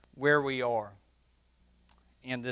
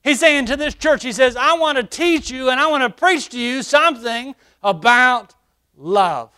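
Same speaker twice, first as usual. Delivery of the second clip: high, loud preacher's cry; full-force preaching; 10 semitones higher, 9 dB louder than usual. Acted. Pastor preaching to the church, ringing out.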